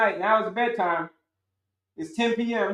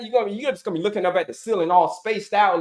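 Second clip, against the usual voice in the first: ordinary talk, nothing marked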